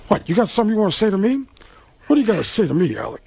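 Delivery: lightly voiced confrontational Pacino, quick; a hint of Pacino squaring up; Pacino impression. Pacino challenging Alec in the hallway, lightly voiced. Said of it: "And he says".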